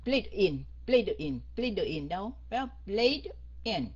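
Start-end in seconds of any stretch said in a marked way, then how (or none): 0.1-2.1 BLADE IN linked quickly with no break; run together. Shown as a demonstration. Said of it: "đâu ai bắt mình phải". 2.9-3.9 BLADE, a clear pause, then IN; stop, then next word. Shown as a demonstration. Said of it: "Stop một chút xíu rồi mình mới đọc".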